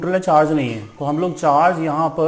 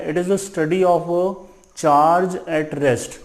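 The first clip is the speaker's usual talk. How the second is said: slow dictation; much slower than usual. Shown as a demonstration. Dictating the definition.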